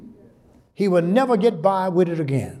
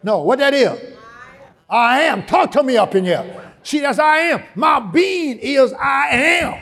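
preaching shout; 7 semitones higher than usual. Real, not acted. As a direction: rise to a full preaching cry.

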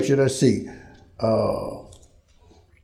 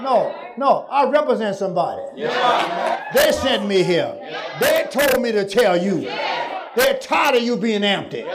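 fiery preaching; 5 semitones higher than usual. Acted. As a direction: preach it up to the rafters. Preaching shout.

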